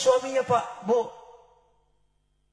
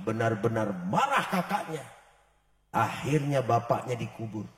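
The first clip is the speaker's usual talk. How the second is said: ordinary delivery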